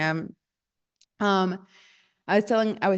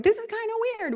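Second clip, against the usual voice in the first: very high-pitched imitated puzzlement; puzzled mock voice; 13 semitones higher, faster than usual. Therapist mimicking client's dawning doubt, high puzzled voice. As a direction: jump way up high, acting out sudden doubt.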